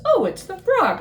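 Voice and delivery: high, sniffy bird voice; snooty head bird. Acted. Head bird at the window greets Frog, high and sniffy. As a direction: peer down your beak at him.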